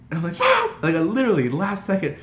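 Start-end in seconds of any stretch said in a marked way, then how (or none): 0.4-0.7 high, loud yelp of "Mom"; panicked yell. Retold or imitated. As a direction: cry out to stop mom, startled. Embarrassed outburst at mother in retelling.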